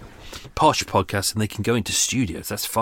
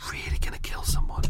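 whispered seething threat; furious whisper; 5 dB quieter than usual. Real, not acted. Vlogger raging at lawnmower neighbour, whispered threat. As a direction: breathe it out in barely held rage. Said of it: "really furious".

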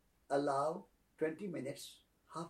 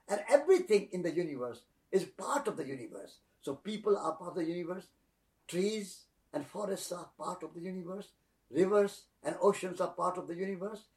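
louder, rising preacher cadence on the universe; uplifting sermon; 3 semitones higher, 4 dB louder than usual. Acted. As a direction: preach it with growing fervour.

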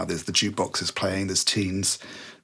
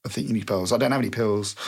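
ordinary talk

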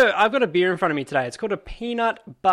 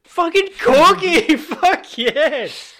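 high, loud, drawn-out cheer; excited shout; 13 semitones higher, 8 dB louder, much slower than usual. Real, not acted. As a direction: yell the name out in triumph. Celebrating the lucky phone call.

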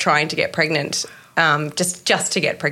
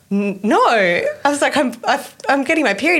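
1 disbelieving; 8 semitones higher than usual. Retold or imitated. Disbelief on the phone.

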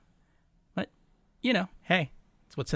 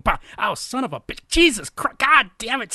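higher, loud cursing building up; mock ow-ow cursing getting louder; pained cursing; 10 semitones higher, 8 dB louder than usual. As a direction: yelp curses as you're hit. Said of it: "they're like".

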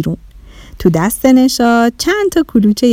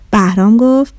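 plain reading voice

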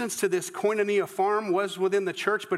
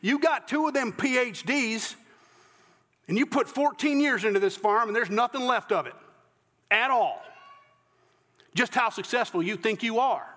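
haughty Southern reporter, pitched high; 5 semitones higher than usual. Acted. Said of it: "said in a haughty voice".

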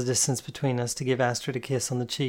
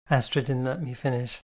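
plain reading voice

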